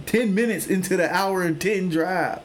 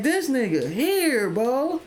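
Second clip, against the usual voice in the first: exasperated; 10 semitones higher, slower than usual. Retold or imitated. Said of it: "And I was like".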